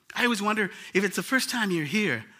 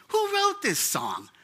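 high, slow wondering "Who wrote this song?"; amazed question; slower than usual. Retold or imitated. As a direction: ask it in wonder, drawn out and much higher. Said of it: "And I said".

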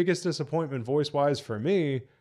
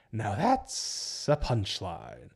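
slow, softer mock villain line; acted punchline; 2 semitones lower, 5 dB quieter, much slower than usual. Reviewer quoting the line after Joker is punched, slow and drawn out. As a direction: draw it out softly for effect. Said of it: "And we get".

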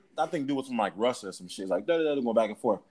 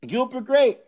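excited; 8 semitones higher, 4 dB louder, slower than usual. Real, not acted. Excitedly repeating the movie title.

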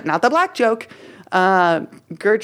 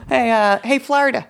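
high, playful call-out; 6 semitones higher than usual. Pretend. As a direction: call out to a buddy. Calling out playfully.

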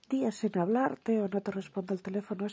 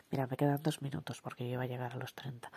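ordinary delivery

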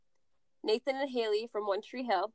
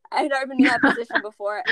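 laughing; 4 semitones higher, 7 dB louder, faster than usual. Real, not acted. Laughing at own angst.

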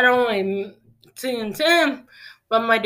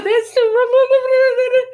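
high-pitched, louder starstruck squeal; 14 semitones higher, 6 dB louder than usual. Retold or imitated. Starstruck fan meeting her favorite singer, squealing. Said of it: "and I lost it".

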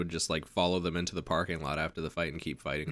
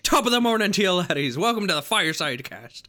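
high, loud hammed-up Irish brogue; mock Irish; 11 semitones higher, 6 dB louder than usual. A podcast host hamming up an Irish welcome, loud mock brogue. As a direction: ham up a stage Irish greeting for the intro. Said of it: "have a whip sound and be like".

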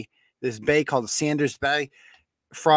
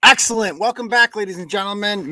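loud, high show-host greeting; in front of an audience; 9 semitones higher, 7 dB louder than usual. Real, not acted. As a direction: hype up the live crowd. Host greets live stream viewers.

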